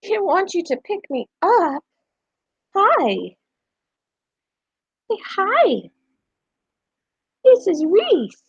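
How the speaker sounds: high, put-upon pet voice; voicing the guinea pig. Owner voicing her guinea pig complaining about being held. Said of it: "She's like".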